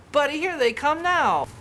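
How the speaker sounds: suddenly spotting the guests arriving; eager announcement; excited. Acted. Spots the friends arriving.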